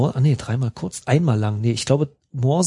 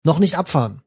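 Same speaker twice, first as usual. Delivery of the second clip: calling out; 4 semitones higher than usual. A narrator's reading. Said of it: "rief der Schmied".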